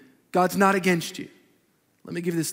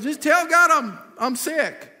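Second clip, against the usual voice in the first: lightly voiced drunk, higher pitch; drunk man pleading; 7 semitones higher, 4 dB louder, slower than usual. Drunk man begs the pastor to tell God he's sick, higher and mildly pleading. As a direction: coax it like a tipsy guy bargaining. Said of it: "He goes".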